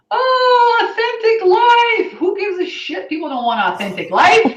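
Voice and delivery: mocking coaches. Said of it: "coaches are out there running around saying".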